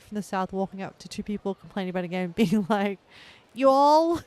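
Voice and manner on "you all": put-on southern accent. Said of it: "I can't do a southern accent".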